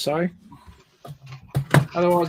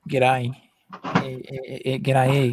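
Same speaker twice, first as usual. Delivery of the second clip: put-on Aussie accent; 4 semitones lower, slower than usual. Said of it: "tried very hard to not get an Australian accent".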